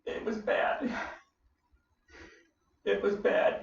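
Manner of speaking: heavy, whispered admission. Real, not acted. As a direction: drop to a hushed near-whisper. Recalling his clinical depression.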